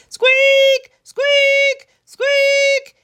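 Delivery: high, loud squeaking hamster; a hamster squeaking urgently at the frog; hamster squeaks. Acted. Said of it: "he says again and again".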